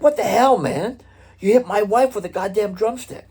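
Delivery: high, loud, outraged demand; angry husband. Retold or imitated. Husband confronting the drummer over his hurt wife, in a high loud outraged voice. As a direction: blast it at him like the furious husband. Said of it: "and he's like".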